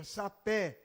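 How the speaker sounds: sapé, o é final aberto e tônico; sapé com é aberto. Shown as a demonstration. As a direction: diga sapé abrindo bem o é. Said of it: "quando ela é sílaba tônica". (sapé com é aberto e tônico)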